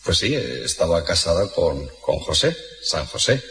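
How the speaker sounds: low, soft hesitant answer; uneasy Pope. Acted. The Pope answering the Turk, low, soft and reluctant. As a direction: answer reluctantly, deep and quiet.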